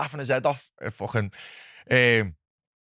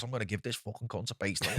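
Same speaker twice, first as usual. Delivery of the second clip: muttered thought; 3 semitones lower, 12 dB quieter than usual. Retold or imitated. Inner thought about the lad.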